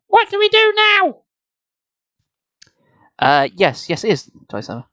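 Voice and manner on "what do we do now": put-on very high girl voice; high girl's worried question; frightened girl. A narrator's reading. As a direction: ask it as a scared young girl. Nicole frightened in a cave.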